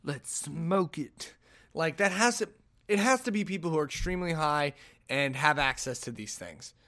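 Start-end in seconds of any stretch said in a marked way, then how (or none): 0.0-1.3 very slow, drawn-out stoner voice; mock-dopey, stretched-out suggestion; stoner decision. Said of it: "and you're like".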